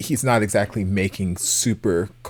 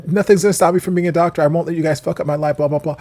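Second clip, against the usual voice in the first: loud, fast, higher mock insistence; hurried, louder mimicry of a stubborn rant; mocking imitation; 7 semitones higher, 8 dB louder, much faster than usual. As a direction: rattle it off dismissively as someone else's rant. Mocking quote of Fluke's doctor obsession.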